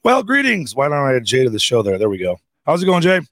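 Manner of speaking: booming show-opening hello; loud greeting. Real, not acted. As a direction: greet the audience big and loud. Host opening live show.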